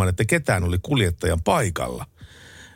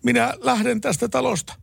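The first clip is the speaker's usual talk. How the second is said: high mock tearful wife, pitched way up; tearful angry wife. Wife threatening to leave the house, high mock tearful. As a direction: wail it as the jilted wife. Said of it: "itkun kiukkuisella äänellä".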